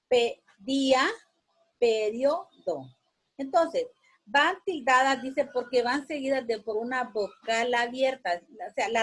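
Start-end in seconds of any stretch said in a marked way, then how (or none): 0.1-2.8 "periodo" spoken slowly, pe-rí-o-do, syllables apart; slow down and break up "periodo". Shown as a demonstration. Teacher to class, sounding out "periodo" slowly. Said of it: "termina en S".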